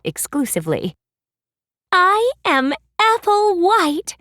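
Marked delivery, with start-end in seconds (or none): 1.9-4.2 high, slow princess declaration; a slow, high fairy-tale princess voice. Acted. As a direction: announce your name sweetly and grandly, much higher. Apple declares her name.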